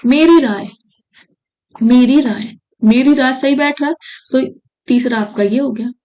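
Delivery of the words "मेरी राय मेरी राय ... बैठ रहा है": ordinary talk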